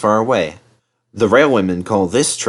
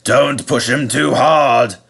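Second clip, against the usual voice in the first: fat controller; 3 semitones higher, 3 dB louder, slower than usual. Acted. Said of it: "warned the fat controller".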